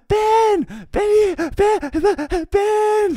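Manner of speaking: very high, loud squeals of a name; excited toddler screaming. Retold or imitated. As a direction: mimic a little girl shrieking like a rock-star fan. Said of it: "screaming his name like he's a fucking rock star".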